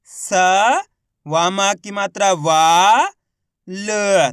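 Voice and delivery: स, long वा, ल with pauses; slow spelling, वा stretched; letter by letter. Shown as a demonstration. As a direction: hold each sound separately. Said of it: "एक अक्षर का उच्चारण करके".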